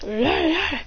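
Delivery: little high mock growls; baby wolf growl. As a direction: growl like a pup.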